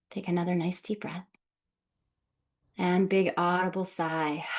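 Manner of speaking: soft voice of a breathing coach; guided meditation. Acted. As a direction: ease them into each breath, calmly. Leads a guided breathing exercise.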